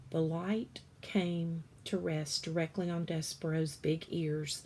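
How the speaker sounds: usual speaking voice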